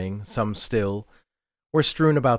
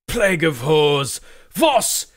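high, loud shout into the chaos; 8 semitones higher, 6 dB louder than usual. Acted. Morgrig shouts for his lieutenant into the smoke, loud high bellow. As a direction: bellow for Voss through the smoke. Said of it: "he shouted into the chaos".

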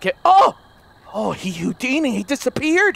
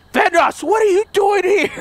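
shouting; 12 semitones higher, 4 dB louder than usual. Real, not acted. Monster at window.

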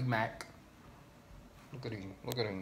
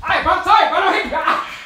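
high, loud apology with laughter; high-pitched laughing plea; loud pleading; 6 semitones higher, 12 dB louder, faster than usual. Real, not acted. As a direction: yelp an apology, laughing, as if dodging a hit. Pleading during prank tussle.

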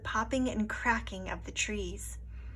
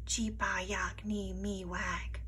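whisper; 2 semitones lower, 7 dB quieter, slower than usual. A narrator's reading. Native name softly spoken.

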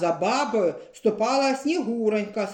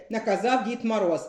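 usual speaking voice

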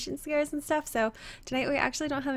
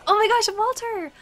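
loud, high, excited exclamation; excited outburst, pitched way up; raised voice; 10 semitones higher, 8 dB louder than usual. Real, not acted. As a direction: cry out in delighted surprise. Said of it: "I can't raise my voice".

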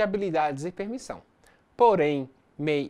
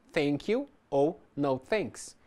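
slow, careful teacher pronunciation; slow model phrase; much slower than usual. Shown as a demonstration. Teacher showing polite refusals to students, slow and clear. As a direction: draw out each word for learners. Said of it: "É adequado, então, utilizar no".

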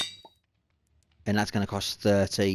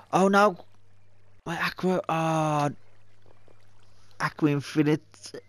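dismayed cry; 8 semitones higher than usual. Real, not acted. Item lost, sudden dismay.

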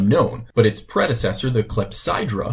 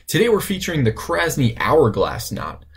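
usual speaking voice

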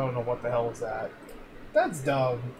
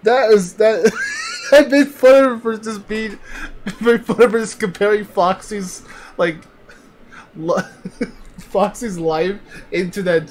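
talking through real laughter, high and loud; real helpless laughter, loud and high; laughing hard; 7 semitones higher, 9 dB louder than usual. Real, not acted. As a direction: crack up while trying to get the story out. Cracking up over funny clip.

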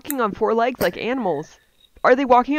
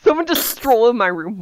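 plain reading voice

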